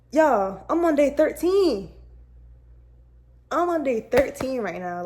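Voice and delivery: loud, high burst of real excitement; proud exclamation. Real, not acted. Telling viewers she's on day thirteen, real proud excitement. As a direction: exclaim it loudly, pitch jumping up.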